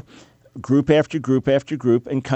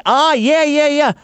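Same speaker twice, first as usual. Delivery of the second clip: loud, very high feigned eureka; mock realization; 13 semitones higher, 4 dB louder than usual. Host feigns working out what links the groups, mock realization. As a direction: pipe up eagerly as if it finally clicked. Pretends to get the third answer.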